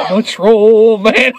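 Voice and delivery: put-on cheeky kid voice, laughing; Bart Simpson. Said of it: "He's like".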